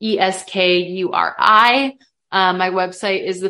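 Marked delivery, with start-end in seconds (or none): 0.0-1.9 letters given slowly for listeners; spelled out slowly. Shown as a demonstration. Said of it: "my Instagram is kate dot escuri".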